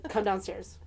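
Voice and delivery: low, soft deadpan; unbothered mom. Retold or imitated. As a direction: casually dismiss the drama, imitating mom. Mother dismisses the drama.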